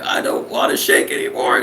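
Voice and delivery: very high-pitched recruit whine; comic distressed squeal; mock desperate plea. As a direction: plead helplessly, hammed up. Acted desperate recruit pleading.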